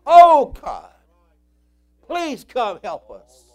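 loud pleading cry, then slow; desperate prayer cry; crying out to God. Real, not acted. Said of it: "I say".

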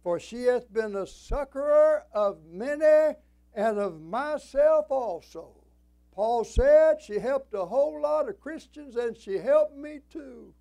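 high, loud, stretched-out proclamation; forceful scripture preaching. Real, not acted. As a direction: ring the verse out to the congregation.

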